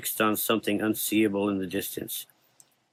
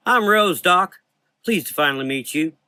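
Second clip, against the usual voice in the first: bright Southern greeting; Southern belle accent; 8 semitones higher, 6 dB louder than usual. Acted.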